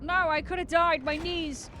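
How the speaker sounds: startled yell about the knees; real panicked yell. Real, not acted. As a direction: cry out as if falling. Character falls in game.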